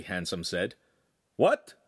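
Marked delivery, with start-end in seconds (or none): 1.4-1.7 sharp, higher, louder surprise; startled by the joke; confused what. A narrator's reading. Master confused.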